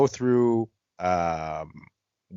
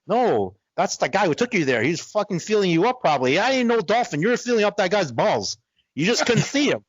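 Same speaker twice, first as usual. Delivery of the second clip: incredulous; 7 semitones higher than usual. Retold or imitated. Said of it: "I'm like".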